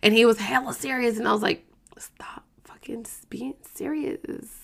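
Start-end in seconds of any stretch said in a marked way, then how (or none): 2.0-4.5 slow, emphatic whisper; whispered scolding. Retold or imitated. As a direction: whisper a telling-off under your breath. Retold hissed aside to groom.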